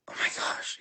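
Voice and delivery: hushed, voiceless mock amazement; imitated whispered gasp of wonder; awed whisper. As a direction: whisper it as if overwhelmed. Retold overwhelmed reaction to gift.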